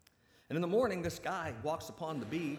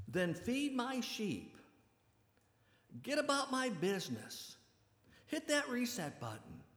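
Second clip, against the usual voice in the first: raised, pitched-up urging, slowing on feed my sheep; fervent charge; 12 semitones higher, slower than usual. Acted. Jesus urges Peter back to his calling, fervently raised. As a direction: push Peter back into the work, all fire.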